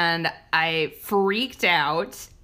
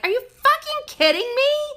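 much higher mock shriek of disbelief; retold disbelief; 3 dB louder than usual. Mom retelling spotting a mouse by her son, high incredulous shriek. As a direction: squeal at the sight of a mouse. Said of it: "I was like".

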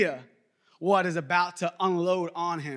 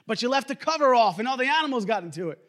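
quick, higher-pitched parental comeback; scolding parent; 5 semitones higher, faster than usual. Retold or imitated. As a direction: fire back as a fed-up parent. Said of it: "my parents used to".

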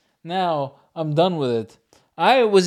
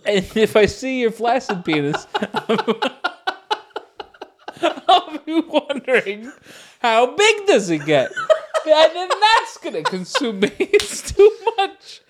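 laughing; 9 semitones higher, 5 dB louder than usual. Real, not acted. Laughing at his own penis fantasy.

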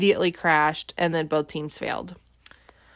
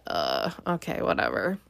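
unimpressed; 9 semitones lower, 5 dB quieter than usual. Retold or imitated. Own unimpressed reaction.

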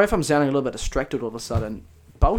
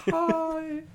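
very high, soft held note; 6 dB quieter than usual. Shown as a demonstration. Answering a co-host's challenge, high note. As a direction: float it up to a soft falsetto. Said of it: "hit that high note with me".

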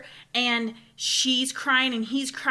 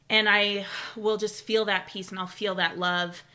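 normal talking voice

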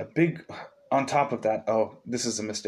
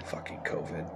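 whispered curse under the breath; whispered aside; 6 semitones lower, 13 dB quieter than usual. Real, not acted. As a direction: mutter it off to the side. Muttered aside while reading.

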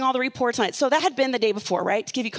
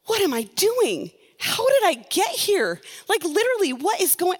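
higher, panicked questions; retold panic; 6 semitones higher than usual. Woman retells her dream panic, voice higher and frantic. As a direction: act out waking up scared in the dream. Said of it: "I was so freaked out".

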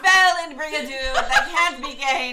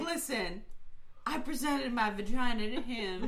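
soft, hushed deadpan; mock solemn confession; 3 semitones lower, 12 dB quieter than usual. [name] explains herself to everyone, mock solemn. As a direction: confide it quietly, mock grave.

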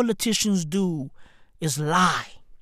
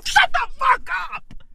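exasperated squeal; 14 semitones higher, 4 dB quieter than usual. Acted. Comedian fed up with Putin talking, exasperated squeal. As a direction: squeeze it out in a high, exasperated voice.

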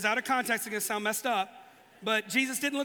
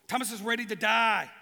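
ordinary talk, nothing marked

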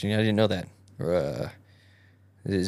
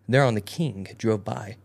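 usual speaking voice

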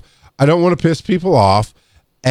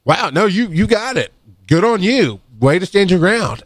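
higher, delighted approval; encouraging praise; 6 semitones higher than usual. Retold or imitated. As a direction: build her up with enthusiasm. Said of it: "I did it in front of the patient".

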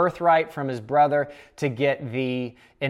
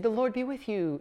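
higher and softer, a kind wish; friendly blessing; 8 semitones higher, 6 dB quieter than usual. Shown as a demonstration. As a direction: say it as a sincere, happy blessing. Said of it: "It's not a happy thing".

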